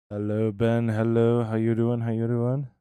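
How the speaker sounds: loud, bright hello to a chatter; excited greeting. Real, not acted. Streamer calling out to Ben in chat, loud excited hello.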